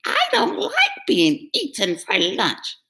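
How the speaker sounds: worried cry. Acted. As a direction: dread the troll. Middle goat voice.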